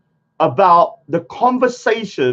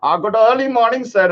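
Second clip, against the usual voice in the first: mock greeting; 4 semitones higher than usual. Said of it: "He's going".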